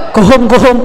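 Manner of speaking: "go home" with thick Javanese o's; Javanese-style pure vowels, no glides; Javanese accent. Retold or imitated. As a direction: mimic pupils slipping into Javanese-accented "go hom". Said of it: "Balik lagi ke Jawa".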